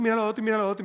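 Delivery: very fast, high, whiny rattle; fast mocking whine; rattled off. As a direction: rattle it off in one breath. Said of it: "medio femenino".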